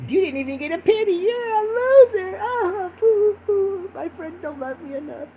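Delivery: high falsetto fake blubbering; hammed-up whiny boo-hoo; mock crying. Mocks himself with fake crying.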